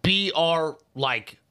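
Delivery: letters spelled out slowly; spelling it out. Shown as a demonstration.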